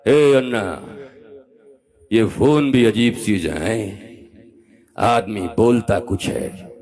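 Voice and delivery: slow, drawn-out film-hero delivery; Amitabh Bachchan. Retold or imitated. Preacher quoting Amitabh's Agneepath line to his audience, slow film-star impression. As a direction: stretch the words like a screen star's dialogue. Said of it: "उसमें कहता है".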